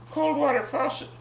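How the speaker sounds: usual speaking voice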